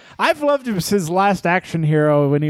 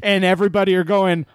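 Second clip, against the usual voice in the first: usual speaking voice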